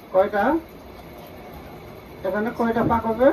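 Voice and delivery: ordinary delivery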